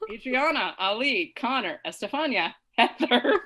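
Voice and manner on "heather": name said through a giggle, rising; laughing. Real, not acted. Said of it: "why you're laughing when you say her".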